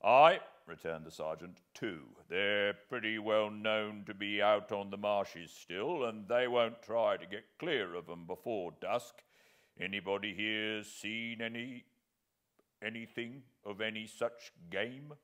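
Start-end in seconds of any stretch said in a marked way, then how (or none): 0.0-0.4 loud, higher bluff aye; hearty aye. Acted. Sergeant answers loudly. 1.7-15.2 a hearty army sergeant reckoning aloud. Acted. Sergeant voiced in the story.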